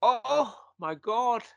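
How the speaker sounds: drawn-out, high Scottish "oh my God"; shocked Scottish accent. Retold or imitated. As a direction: imitate a shocked Scot, stretching it out slowly. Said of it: "in his Scottish accent".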